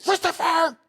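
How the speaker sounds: put-on high exasperated yell of the child's name; high-pitched shouted Christopher. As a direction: yell for the kid in alarm. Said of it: "oh boy".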